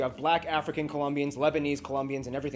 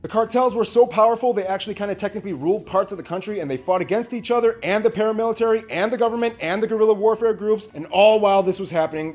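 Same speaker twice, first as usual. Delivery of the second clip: loud rapid-fire recap; rapid condensed summary; 2 semitones higher, 7 dB louder than usual. Real, not acted. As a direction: pile it up without stopping. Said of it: "condense this in the quickest way".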